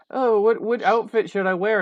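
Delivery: high, loud prissy mock of the heroine; mocking Gal Gadot. Critic mocking the heroine's dialogue in a high, loud voice. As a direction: ham up a vain heroine picking clothes. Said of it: "and it's just like".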